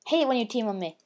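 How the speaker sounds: ordinary talk, nothing marked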